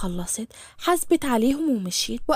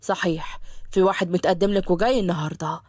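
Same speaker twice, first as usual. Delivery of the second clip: low fatherly voice, matter-of-fact news; father's voice; 8 semitones lower than usual. Acted. Father tells of a suitor.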